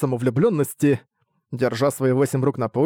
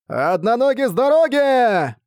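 shout; 10 semitones higher, 4 dB louder, slower than usual. Acted. Deaf man yells falling from sky.

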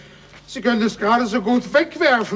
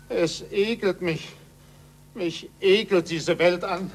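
quiet disgust; 4 semitones lower, 7 dB quieter than usual. Acted. Disgusted curator turns away.

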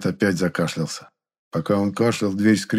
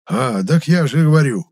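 put-on old man flaring up, higher and louder; cross, loud retort from an old man; suddenly angry; 7 semitones higher, 7 dB louder than usual. A narrator's reading. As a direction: raise your voice in sudden irritation. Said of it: "внезапно рассердился старик".

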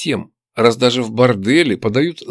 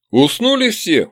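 higher-pitched yell for attention; 8 semitones higher, slower than usual. A narrator's reading. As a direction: keep hollering for service. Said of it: "продолжил я орать". (орать, требуя внимания)

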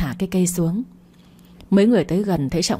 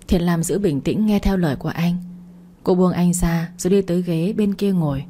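usual speaking voice

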